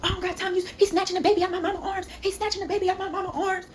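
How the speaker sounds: high-pitched mock ranting; high, indignant mimicry without added volume; imitated angry relatives. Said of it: "my mom and my auntie storming outside".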